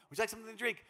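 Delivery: a future mother-in-law keeps pressing her son's fiancée to eat; mother offering. Retold or imitated. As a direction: play the eager mother-in-law.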